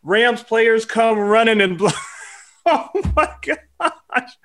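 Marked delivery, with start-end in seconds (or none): 2.3-4.3 high, slow words squeezed through laughter; laughing. Real, not acted. Host laughing at the tunnel brawl story, slow and high through laughter. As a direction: crack up as you say it.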